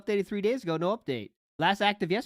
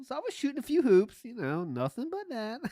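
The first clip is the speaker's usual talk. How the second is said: higher voice, softening to a smug aside; mock smug storyteller; 6 semitones higher than usual. Host predicting the storyteller's brag, mock-casual smugness. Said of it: "That's what Phil's gonna say".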